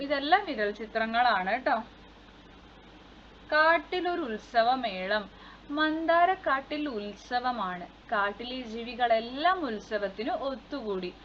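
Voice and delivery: ordinary talk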